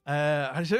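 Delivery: ordinary talk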